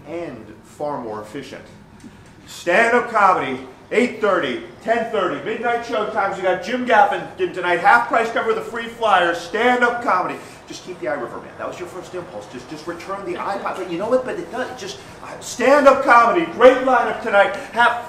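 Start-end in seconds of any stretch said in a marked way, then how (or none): 2.5-10.4 loud, higher hawking call; street barker. Shown as a demonstration. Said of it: "I shout my sales pitch". 15.4-18.1 much louder, higher hawking shout; loud barker call. Shown as a demonstration. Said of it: "I shout my sales pitch".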